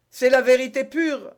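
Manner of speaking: high, loud protest; exclamation. A narrator's reading. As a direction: cry it out insistently. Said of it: "s'écria le mercier". (exclamation aiguë et sonore)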